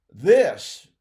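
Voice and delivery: "This" hit hard and loud; emphatic. Shown as a demonstration. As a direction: punch the word "This" with force. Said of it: "this is emphatic".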